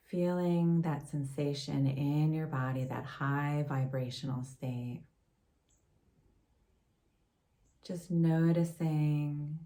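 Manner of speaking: calm, unhurried meditation voice with long pauses; guided meditation. Acted. Yoga teacher leading a gratitude meditation for listeners, guided meditation. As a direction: gently guide them inward, leaving space between phrases.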